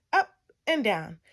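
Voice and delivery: usual speaking voice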